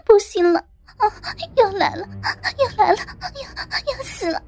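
acted breathless moaning, broken into short phrases; gasping cries. A narrator's reading. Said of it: "口中嘶喊着".

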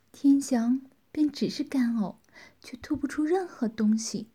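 plain reading voice

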